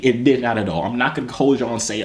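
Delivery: ordinary talk, nothing marked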